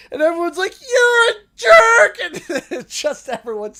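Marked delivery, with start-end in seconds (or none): 0.8-2.2 high, loud, slow insult; angry yell. Retold or imitated. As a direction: scream it at the driver from the car. Everyone screaming at driver.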